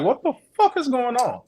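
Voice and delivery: high-pitched retold bewilderment; baffled reaction. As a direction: shoot the pitch up in recounted disbelief. Retelling his baffled reaction.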